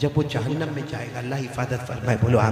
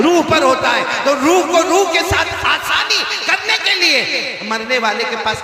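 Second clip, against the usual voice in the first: raised, ringing sermon voice; impassioned preaching; 7 semitones higher, 6 dB louder than usual. Real, not acted. Preacher teaching congregation about Surah Yasin, impassioned. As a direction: drive the point home, high and forceful.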